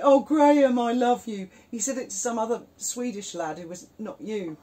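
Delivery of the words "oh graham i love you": put-on loud, high drunk gushing at Graham; the drunk man thanks his rescuer, loud and slurry; drunken declaration. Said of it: "Pissed as a fart".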